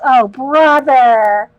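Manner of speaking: drawn-out loud "oh, brother"; slow, loud mock exasperation; loud groan. Real, not acted. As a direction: groan loudly at a bad pun. Groaning at a chat pun.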